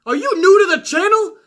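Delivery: high, loud incredulous outburst; who do you think you are, yelled high; retold outburst. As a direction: blast him, outraged and shrill. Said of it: "I'm like".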